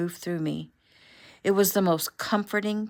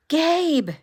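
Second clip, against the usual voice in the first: high, loud delighted cry of his name; excited cry; 11 semitones higher, 7 dB louder than usual. Acted. Friend on the phone shouting Gabe's name in delight. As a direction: she can't believe it's really him. Caller thrilled to hear Gabe.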